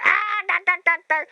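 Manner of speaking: high, loud mock yelling; mimicked outburst. Retelling a friend kicked out front, mimicked outburst. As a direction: babble it high like an angry woman. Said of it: "She's going".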